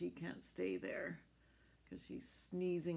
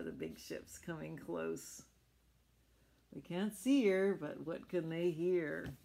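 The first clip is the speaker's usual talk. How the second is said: plain reading voice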